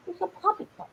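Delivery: high cooing pet voice. Real, not acted. Owner cooing at her puppy. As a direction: coo softly.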